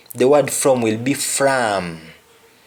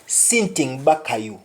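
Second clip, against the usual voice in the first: "Kupa near back" in a Jamaican Patois lilt, with a rising note of warning. Shown as a demonstration. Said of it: "You tell somebody".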